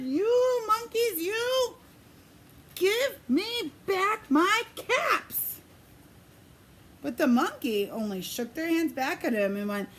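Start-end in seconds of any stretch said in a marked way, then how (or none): 0.0-5.6 angry scolding. Acted. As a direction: scold the monkeys, shaking a finger. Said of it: "This made the peddler very angry".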